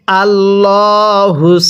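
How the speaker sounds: slow, with the final স held long; recited syllable. Shown as a demonstration. Teacher spelling the verse for students, drawn-out chant. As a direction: stretch আল্লাহুস and hold the স. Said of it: "হা সদ পেশ হুস".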